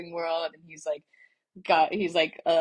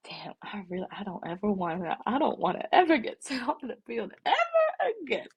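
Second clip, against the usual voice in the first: emotional vow; 5 semitones higher than usual. Retold or imitated. Retold emotional vow after send-off.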